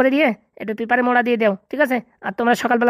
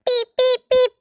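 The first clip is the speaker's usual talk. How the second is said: very high beeping; beep imitation; 12 semitones higher, much slower than usual. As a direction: squeak out the hang-up beeps. Call cut tone imitated.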